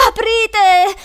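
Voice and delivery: high, loud scream to open up; terrified yell. Acted. Boy feeling the hand near his neck, terrified yell. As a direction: scream for someone to let you out.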